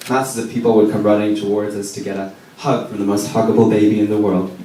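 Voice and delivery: usual speaking voice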